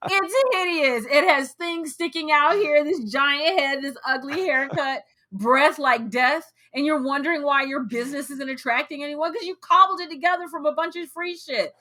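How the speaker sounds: high-pitched exasperated rant; heated rant. Real, not acted. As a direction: let the annoyance run hot. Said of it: "tell us how you really feel".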